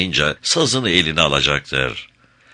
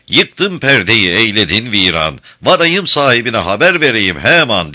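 loud, high shout at Hacivat; Karagöz shouting; 4 semitones higher, 5 dB louder than usual. Acted. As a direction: yell it like a puppet quarrel. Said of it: "diyerek kendini dışarı atacaktır".

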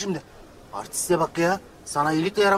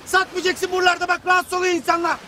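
scolding; 11 semitones higher, 4 dB louder than usual. Acted. Scolding vendor in front of guests.

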